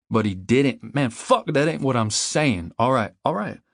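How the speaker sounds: flustered protest. A narrator's reading. Friend backpedals about the rumour, flustered and protesting. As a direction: argue back, getting worked up. Flustered argument over what was said.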